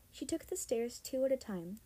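usual speaking voice

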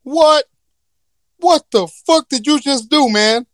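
hammed-up angry genie shout; outraged genie. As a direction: yell at the man who freed you. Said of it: "He gonna be like".

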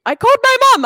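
high insistence on called; I "called" my mum, up high; emphatic. Real, not acted. Emphatic correction.

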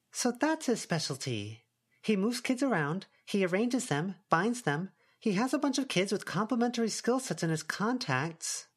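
lightly voiced girl Avery. A narrator's reading.

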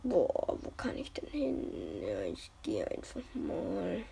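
soft whisper to himself. Real, not acted. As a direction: whisper it under the breath. Whispers while playing.

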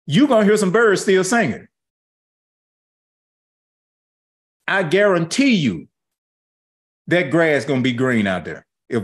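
rousing preaching refrain. Acted. Pastor assuring the congregation, rousing preaching. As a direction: build each I guarantee you.

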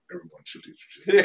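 high, laughing through it. Real, not acted. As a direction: joke it out with a laugh. Joking answer as hero.

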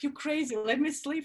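groggy protest. Retold or imitated. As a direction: whine to be left asleep. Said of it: "I'm like".

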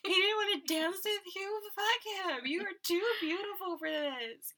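put-on high, gushing drunk girl; giddy party girl. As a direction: gush like a tipsy friend in the bathroom. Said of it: "and you're like".

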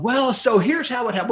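hammed-up high voice of himself on air, accent unsure; a playful high-pitched put-on voice; a lightly comic on-air interviewee; mock German accent. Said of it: "and I'm going".

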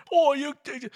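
high mock British accent; thick British accent. Drunk passenger starting on flight attendant, mock thick British accent. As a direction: start a hammed-up British rant. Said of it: "like, a thick ass accent".

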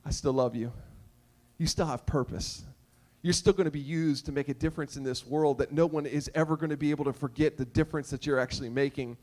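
low, softened voice of forgiveness; reassuring Jesus. Retold or imitated. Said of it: "Jesus is still like".

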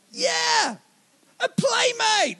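high, squealing dog thrilled to play; a dog that spots a new playmate; excited dog voice. Retold or imitated. Dog excited by running child.

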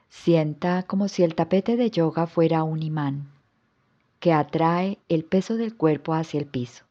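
calm yoga nidra guidance with long pauses; guided relaxation. Acted. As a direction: guide the listener into rest, phrase by unhurried phrase. (relajación guiada, con calma y pausas largas)

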